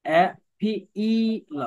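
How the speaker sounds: এ পি ই ল with the ই held long; long sound. Shown as a demonstration. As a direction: stretch the ই in appeal. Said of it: "এটা দীর্ঘ long sound হবে".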